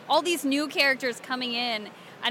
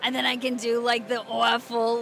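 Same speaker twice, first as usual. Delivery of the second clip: ordinary talk, nothing marked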